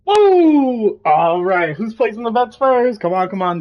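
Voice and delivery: hyped bookie. Acted. Character calling for bets, loud and hyped. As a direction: rile up the crowd to bet. Bettor hyping the crowd.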